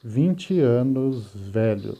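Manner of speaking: slow, word-by-word literal translation; careful classroom word-for-word read. Shown as a demonstration. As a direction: spell out each word slowly. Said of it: "vai ficar assim".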